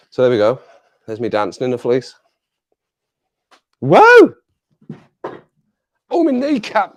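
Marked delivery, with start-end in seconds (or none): 3.8-4.3 sudden very high, loud yelp of surprise; startled yelp. Real, not acted. Streamer banging his knee on the desk, loud high startled yelp. 6.1-6.9 high, pained groan of the words; real pain. Real, not acted. Streamer who just smashed his kneecap, high pained cry. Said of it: "I smashed my kneecap".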